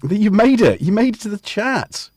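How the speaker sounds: ordinary delivery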